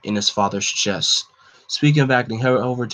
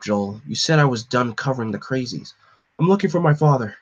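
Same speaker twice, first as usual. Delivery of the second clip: plain reading voice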